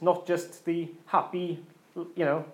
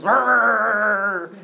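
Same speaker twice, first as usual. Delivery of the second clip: angry growl; 3 semitones higher, 7 dB louder than usual. Retold or imitated. Preacher acting out Anger from Inside Out, loud growl. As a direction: growl it out like a tiny hothead. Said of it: "Then you've got Anger".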